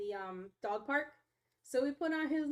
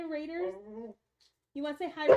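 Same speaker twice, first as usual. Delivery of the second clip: ordinary talk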